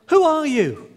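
high, loud call across the valley; boy calling into the valley, surprised. Retold or imitated. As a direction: shout out to find who's there. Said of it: "To his surprise, he said".

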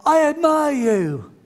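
dad's son calls out kindly to the echo; called out. Retold or imitated. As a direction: send praise across the valley. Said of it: "his dad shouted out".

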